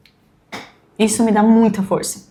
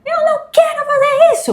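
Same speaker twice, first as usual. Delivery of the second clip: put-on much higher, whiny kid voice; whiny child imitation. Mother mimicking her son refusing, in a high whiny child voice. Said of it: "que às vezes ele faz".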